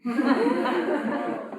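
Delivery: a real, helpless laugh; burst of laughter. Real, not acted. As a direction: laugh out at the grim joke. Host reacts to bleak "message of hope.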